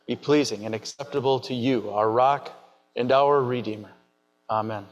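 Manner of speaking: low, solemn opening prayer; formal liturgical prayer voice. Acted. Opens the sermon with prayer.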